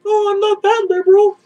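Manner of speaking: high simpering falsetto; mock timid liberal. Mocking liberals who distance themselves, simpering high voice. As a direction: cringe away in a whiny high voice.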